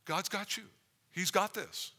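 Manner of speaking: fervent full-voiced sermon; preaching.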